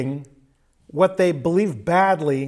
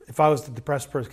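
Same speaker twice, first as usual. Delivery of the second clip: ordinary talk, nothing marked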